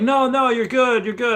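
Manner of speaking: reassuring. Retold or imitated. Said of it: "I'm like".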